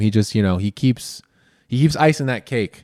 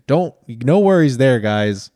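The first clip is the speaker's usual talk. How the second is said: ordinary delivery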